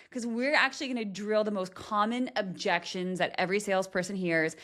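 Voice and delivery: ordinary talk, nothing marked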